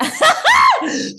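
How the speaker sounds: high squealing burst of laughter; loud shrieking laugh. Real, not acted. Loud laugh after quote.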